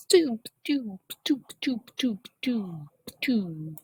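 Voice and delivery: high whispered "doop" beeps; breathy, playful sound-effect doops. Pretend. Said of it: "it's like".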